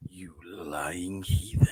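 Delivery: low, slow whispered threat; menacing whisper. Retold or imitated. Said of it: "Jim Cummings looking down at him".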